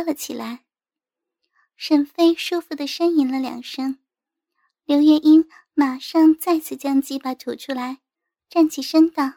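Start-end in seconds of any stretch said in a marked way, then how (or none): none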